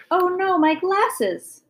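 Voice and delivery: high, drawn-out lament; dismayed. A narrator's reading. Guest realizing her glasses got stepped on, dismayed. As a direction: wail it slowly in dismay.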